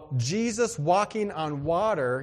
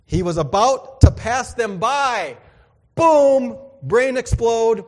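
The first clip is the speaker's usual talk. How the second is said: louder, slower excited buildup; 6 semitones higher, 10 dB louder, slower than usual. Real, not acted. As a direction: burst with wonder.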